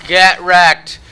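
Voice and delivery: high, loud taunting shout; shouted gloat. Real, not acted. Gamer gloating over beaten opponent, shouted gloat. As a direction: yell it at the loser.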